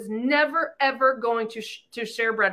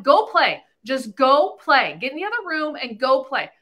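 louder, impatient go play; impatient mom; 2 semitones higher, 5 dB louder than usual. Retold or imitated. Mother shooing her kids away while on phone, impatient and louder. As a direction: brush the kids off sharply. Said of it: "And I'm like".

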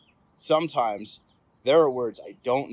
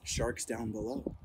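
softer and slow, spooky reveal; hushed storybook menace; hushed suspense; 4 semitones lower, 11 dB quieter than usual. A narrator's reading. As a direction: drop low for the sharks. Suspense after gasp.

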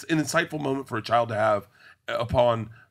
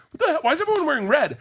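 high-pitched, incredulous mock outburst; baffled boys; 4 dB louder, faster than usual. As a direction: imitate confused boys demanding an explanation.

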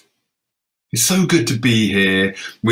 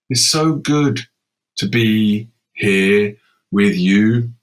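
very slow; slower than usual. Acted. Refrain drawn out slowly.

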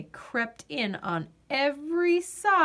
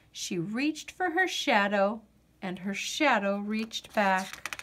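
plain reading voice

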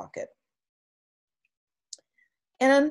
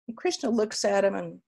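ordinary delivery

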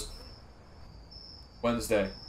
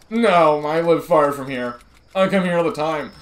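annoying Sheldon voice; 3 semitones higher, 7 dB louder than usual. Acted. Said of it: "the most annoying young Sheldon voice ever".

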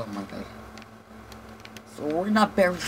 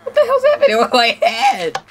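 high, loud, laughing shout; laughing yell; 10 dB louder than usual. Real, not acted. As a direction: yell it while cracking up. Laughing at upside-down head.

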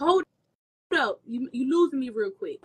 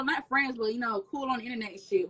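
ordinary talk, nothing marked

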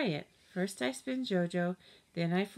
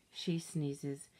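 ordinary delivery

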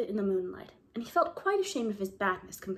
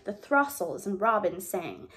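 ordinary delivery

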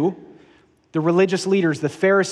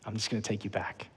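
a much softer, lower repeat, almost to himself; hushed father; 8 semitones lower, 15 dB quieter, faster than usual. Retold or imitated. Father softly welcoming his lost son home, hushed. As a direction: breathe it out low and soft.

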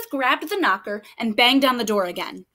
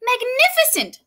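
much higher, delighted magnificent; gushing praise; 10 semitones higher than usual. Acted. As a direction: flatter him to the skies. Elizabeth praises dragon.

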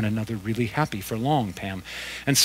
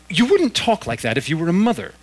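indignant ghost mother; 6 semitones higher, 6 dB louder than usual. A narrator's reading.

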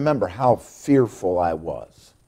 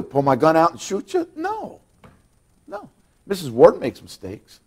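normal talking voice

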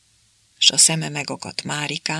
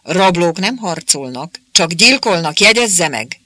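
louder, angry lecturing; 3 semitones higher, 6 dB louder than usual. A narrator's reading. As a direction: hammer each word home in anger. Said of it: "mérgesen".

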